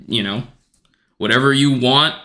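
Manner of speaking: ordinary talk, nothing marked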